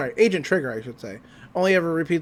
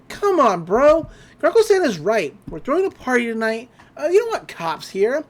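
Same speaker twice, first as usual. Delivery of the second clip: put-on high-pitched, cajoling kid voice; coaxing sister; 5 semitones higher than usual. Mabel talking Dipper into the party, acted coaxing. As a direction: coax a brother in a raised, bright pitch.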